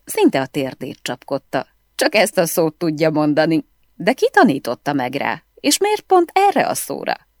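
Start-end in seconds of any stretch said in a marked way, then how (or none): none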